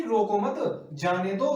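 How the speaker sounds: very slow, रोको मत grouped, then जाने दो; pause after मत. Shown as a demonstration. Teacher explains don't stop him at all, pause after मत. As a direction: say रोको मत firmly together, break before जाने दो. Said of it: "इसे रोको मत बिल्कुल भी".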